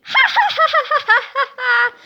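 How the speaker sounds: high, loud hammed-up hero laugh; big triumphant cartoon laughing; heroic laugh. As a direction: laugh like a goofy superhero. Captain Underpants laughs.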